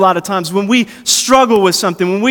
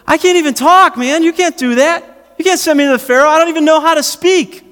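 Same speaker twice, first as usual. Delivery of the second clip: mock Moses whine; 8 semitones higher than usual. Moses protesting to God, high panicky whine. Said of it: "He goes".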